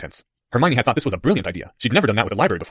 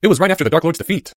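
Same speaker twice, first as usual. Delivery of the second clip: put-on mildly grave boy, pitched up; earnest schoolboy explanation; serious; 6 semitones higher, 4 dB louder than usual. A narrator's reading. Boy answering his friend's doubt, lightly serious. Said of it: "his voice serious as he said it".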